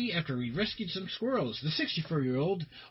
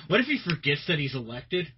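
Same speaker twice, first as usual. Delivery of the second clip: usual speaking voice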